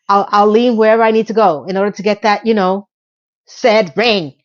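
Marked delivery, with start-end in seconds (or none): none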